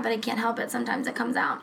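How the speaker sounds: ordinary talk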